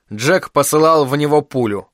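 ordinary delivery, nothing marked

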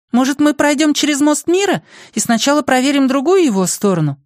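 put-on very high-pitched girl's voice; high girlish character voice; girl making a suggestion. A narrator's reading.

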